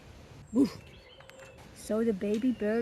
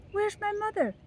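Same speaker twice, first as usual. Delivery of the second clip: put-on high little baby bird asking; 9 semitones higher than usual. Newly hatched chick asking for mother, high and small.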